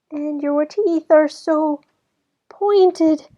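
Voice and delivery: high, slow, scared gasp; terrified girl; gasping in fear. A narrator's reading.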